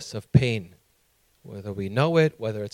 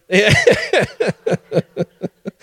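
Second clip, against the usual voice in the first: real high-pitched burst of laughter, trailing off; 8 semitones higher, 3 dB louder than usual. Real, not acted. Preacher joking about legal drugs, laughing high. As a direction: laugh hard at your own joke.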